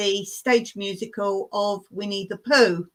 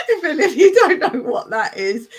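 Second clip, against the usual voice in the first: higher, louder mock scolding; laughing mock telling-off; playful teasing; 5 semitones higher, 5 dB louder than usual. Real, not acted. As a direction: tease the viewers affectionately.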